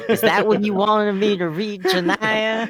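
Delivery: high, slow, put-on Dirk voice. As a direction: stay in the Dirk voice. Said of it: "thank you, Dirk".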